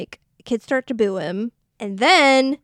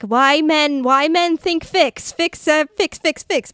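higher, louder, hammed-up complaint; mock exasperated rant; 5 semitones higher, 4 dB louder, slower than usual. Acted rant of exasperated woman.

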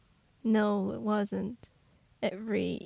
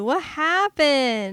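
slow, higher wail; mock dismay; 4 semitones higher, 3 dB louder, much slower than usual. Fan mourning Elizabeth Shue's bad acting, slow wail. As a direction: drag it out in disbelief. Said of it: "Elizabeth Shue?".

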